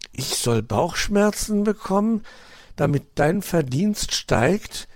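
put-on high, questioning young fox; doubtful. A narrator's reading. Nephew doubts his uncle's greedy plan, sceptically. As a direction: question it in disbelief. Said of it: "meldete Weißohr Zweifel an".

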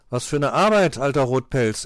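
loud, surly comeback; unfriendly retort. A narrator's reading. Pechmarie answers the fox back, unfriendly. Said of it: "erwiderte sie unfreundlich".

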